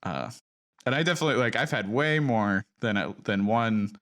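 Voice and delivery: ordinary delivery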